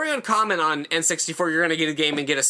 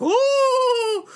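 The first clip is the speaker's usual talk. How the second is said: high, drawn-out ghost wail; loud spooky hoot like a vanishing ghost; ghostly "ooh"; 4 dB louder than usual. Retold or imitated. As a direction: wail "ooooh" like a cartoon ghost. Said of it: "like, a ghost Kong".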